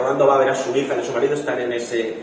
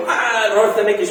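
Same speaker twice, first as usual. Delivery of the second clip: excited; 9 semitones higher, 3 dB louder than usual. Retold or imitated. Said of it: "Y se lo dije".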